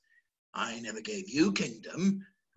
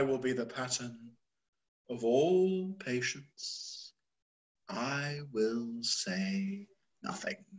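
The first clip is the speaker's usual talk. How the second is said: slow, much softer, withdrawn; resigned hush; 2 semitones lower, 8 dB quieter, slower than usual. Acted. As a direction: let it sink into quiet resignation. Lear restraining himself.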